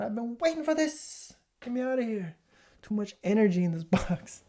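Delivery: a tissue begging to escape; the tissue pleading to get out of the box, high and frantic; voicing the tissue.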